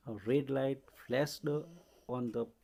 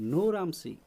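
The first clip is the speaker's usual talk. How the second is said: mild narrated shout, higher and louder; nervous shout; 6 semitones higher, 7 dB louder than usual. A narrator's reading. Prem Chopra protesting to Ramsing in court, nervous raised voice. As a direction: call it out in alarm, without a full yell. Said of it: "shouted nervously".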